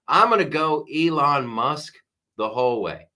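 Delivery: usual speaking voice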